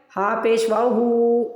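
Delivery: हु held long and slow at the end; slow stretched spelling. Shown as a demonstration. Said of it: "तो ये stretch हो गया". (धीरे-धीरे, हु को खींचकर)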